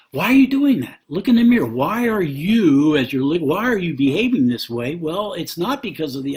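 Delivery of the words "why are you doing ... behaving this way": much higher and louder, pressing the question; rebuking call from God. Acted. God calling a quarreling spouse to look within, raised and high. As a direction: demand why, voice raised and high. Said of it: "what God would do would say".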